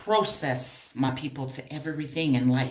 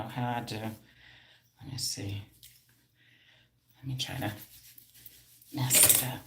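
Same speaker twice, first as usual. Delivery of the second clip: whisper; 6 semitones lower, 13 dB quieter than usual. Real, not acted. Whispers while fixing her hair.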